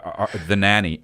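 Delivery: ordinary talk, nothing marked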